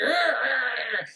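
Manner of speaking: hammed-up "arr" of a lunging attacker; mock attacker's growl. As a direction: growl like a cartoon brute. Imitating the attacker's noises.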